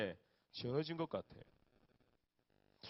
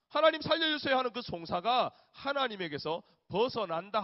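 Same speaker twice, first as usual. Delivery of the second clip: fervent scripture reading; 4 semitones higher, 7 dB louder than usual. Acted.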